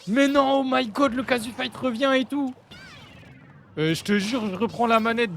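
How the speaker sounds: high, loud mock excitement; imitated hype. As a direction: mimic a thrilled friend's outburst. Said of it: "Keizo, pareil".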